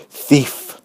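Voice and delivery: from a low charge to a loud cry; building dreadful accusation. A narrator's reading. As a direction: hammer the word thief, growing each time. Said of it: "In a low but dreadful voice".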